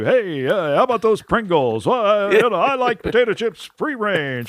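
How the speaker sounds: a hint of a dry political humorist; Mark Russell. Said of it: "watching Mark Russell do".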